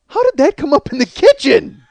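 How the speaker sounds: loud, high-pitched disbelief; shrieking mock outrage; incredulous wife. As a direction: imitate an appalled wife demanding an explanation. Wife's incredulous question, retold.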